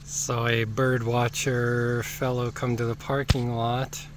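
usual speaking voice

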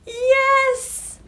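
high, loud joyful shout; excited cheer. Acted. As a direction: cheer like you just found it. Mouse found under orange house.

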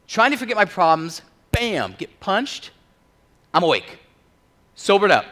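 loud, high, punchy bursts; jolting re-enactment. Acted. Preacher reliving the punch that sobered him, jolting bursts. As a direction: fire each beat off like a blow.

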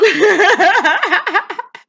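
a burst of high, loud laughter. Real, not acted. Preacher laughing at his own joke to the class, loud and high.

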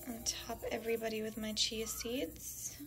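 breathy whisper under her breath. Real, not acted. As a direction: whisper it to yourself. Quiet aside while topping bowl.

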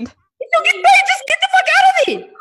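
high, loud, panicked shout. Acted. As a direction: yell at them to get out. Producer panics, yells to flee.

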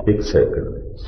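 "ਇੱਕ ਸੈਕਿੰਡ ਦੇ ਵਿੱਚ" breathed out in a soft whisper; whispered echo. Real, not acted. Preacher whispers "ਇੱਕ second ਦੇ ਵਿੱਚ" back to the congregation.

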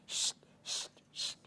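short unvoiced hushing bursts; monkeys' shushing. A narrator's reading. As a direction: imitate monkeys with soft, separate shushes. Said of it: "shook both of their hands and went".

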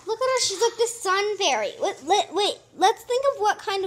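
delighted excited reveal. Real, not acted. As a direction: show her off with delight. Fairy doll revealed.

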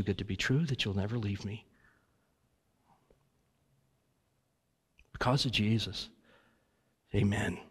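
earnest prayer voice, pausing often; heartfelt prayer. Real, not acted. A pastor praying aloud to God for the congregation, earnest and halting.

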